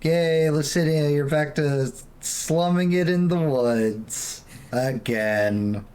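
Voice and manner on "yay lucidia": normal talking voice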